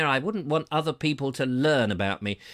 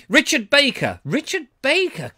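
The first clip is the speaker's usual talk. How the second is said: delighted recognition; 8 semitones higher, 5 dB louder than usual. Real, not acted. Excited recognition of a name.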